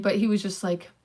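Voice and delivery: ordinary talk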